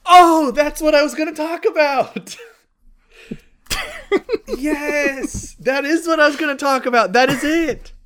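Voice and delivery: loud and high, thrilled; excited. Real, not acted. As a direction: shout it with delight. Realizes it's her topic.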